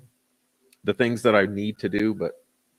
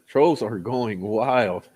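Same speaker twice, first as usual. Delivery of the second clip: slow drawn-out repeat; much slower than usual. Acted.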